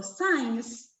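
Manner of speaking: slow "science" with an S sound at the start; English word demo. Shown as a demonstration. Teacher showing that SC sounds like S, slow. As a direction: say "science" slowly so the S is heard. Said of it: "Si yo digo".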